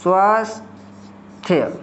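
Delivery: slow, stretched dictation of the word. Shown as a demonstration. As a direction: say स्वास्थ्य slowly so students can hear each sound. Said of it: "दूसरा ले लें स्वास्थ्य". (स्वास्थ्य को धीरे-धीरे खींचकर बोलना)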